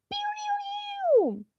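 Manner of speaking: very high zapping pew noises. Acted. Streamer imitating a meme laser, high pew noises. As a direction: zap it out like a laser gun.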